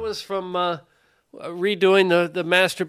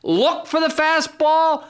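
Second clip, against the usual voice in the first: high, insistent, punched-out pleading; exasperated rant; 9 semitones higher than usual. Real, not acted. Father pleading for one pulled fastball, exasperated rant. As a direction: harangue at a high pitch, climbing to a holler.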